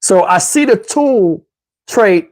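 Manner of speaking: ordinary delivery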